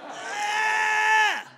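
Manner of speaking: loud, sustained high shriek; high scream. Acted. Comic ending an outraged outburst, high loud scream. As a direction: shriek at the top of the range.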